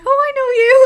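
very high, loud recognising squeal; delighted mother. Retold or imitated. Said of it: "she was like".

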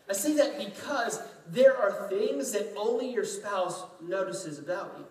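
ordinary talk, nothing marked

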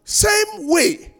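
fervent preaching. Acted. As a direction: preach it out to the congregation. Pastor preaching with fervour.

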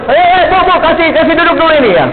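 a hint of an eager bus tout herding a passenger; pushy tout. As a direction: rush the passenger aboard like a calo. Tout hustling passenger onto bus.

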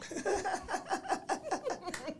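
long high-pitched laugh. Real, not acted. Guest delighted after the remix, laughing. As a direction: laugh heartily and at length.

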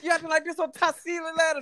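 put-on high West Indian accent, preaching about energy. Cook lecturing rude customer, West Indian accent. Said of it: "a West Indian accent".

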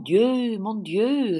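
quoting the husband's cry with a hint of French; French exclamation.

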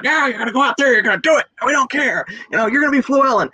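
high-pitched, hammed-up pushy director; brash, careless director. Director ordering young actor into a role, mock brash insistence. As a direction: imitate a crazy director brushing off objections. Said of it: "the director was this, like, really interesting guy who didn't care".

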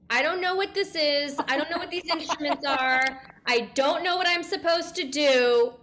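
high, mounting mock freak-out; hammed-up wail of helpless confusion; retold panicked yelling. Newcomer freaking out at the sound bath, mock panicked yelling. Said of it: "I'm yelling out there".

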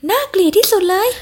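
very high-pitched, scandalized squeal; shocked cry. A narrator's reading. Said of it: "ซาเรียร้องพลางยกมือปิดตา". (ร้องเสียงแหลมสูงด้วยความตกใจ)

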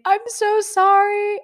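high, loud, drawn-out wail; freaking out. Retold or imitated. Driver apologizing to guy she hit, freaking out. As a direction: imitate your own bawled apology, slowly stretched. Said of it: "I was just freaking out".

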